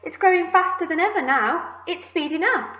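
high, urgent exclamation; excited shout. A narrator's reading. Aunt watching the peach speed up, excited shout. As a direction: call out the news excitedly. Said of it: "Aunt Spiker shouted".

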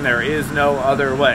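usual speaking voice

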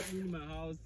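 ordinary talk, nothing marked

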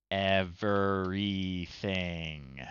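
very slow, stretched-out everything; long greedy everything; drawn-out everything. Real, not acted. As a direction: savour the word, wanting it all. Ordering all hamburger toppings.

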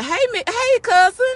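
high, loud happy hello to a cousin; young people greeting cousins, high and bright; excited greeting. Retold or imitated. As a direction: call out gladly across the room. Youth greeting at church, mimicked.

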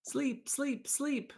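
put-on very high sleepy baby voices; drowsy little chicks; tired babies. A narrator's reading. Tired babies in the nest, sleepy and high. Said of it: "the tired babies finally whispered".

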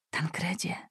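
soft breathy whisper of a name. Acted. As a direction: call him in a whisper from the trees. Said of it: "szepnął jakiś głos".

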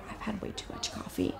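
very soft, nearly voiceless; hushed aside. Acted. Nervous dater to her date about too much coffee, hushed aside. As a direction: drop it right down, as if to yourself.

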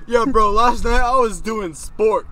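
bragging bro voice. Retold or imitated. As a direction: boast like a gym bro. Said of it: "And this one guy".

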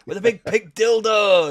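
Geordie quote. Retold or imitated. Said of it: "You're like".

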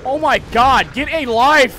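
exasperated yell. Real, not acted. Exasperated at chasing enemy.

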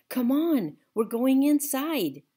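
loud, high call; hollered. A narrator's reading. The narrator calls Otis inside, loud. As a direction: urge him in over the rain. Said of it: "I hollered at him over the rain".

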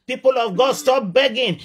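ordinary delivery

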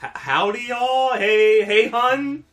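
put-on Southern accent. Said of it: "really try to sound like a Southerner".

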